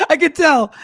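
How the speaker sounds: high-pitched, laughing through the words; real laughing. Real, not acted. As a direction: crack up around the line. Amused that co-host wasn't listening.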